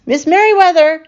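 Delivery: high, loud urgent call. A narrator's reading. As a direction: call her as you hurry down the hall. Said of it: "he called as he walked".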